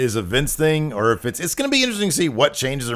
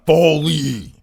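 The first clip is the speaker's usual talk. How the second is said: loud gruff wrestler shout that slips into Ryback; Ryback impression; 4 semitones higher, 4 dB louder than usual. Shown as a demonstration. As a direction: bark the name like a big wrestler. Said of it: "I always slip into a Ryback".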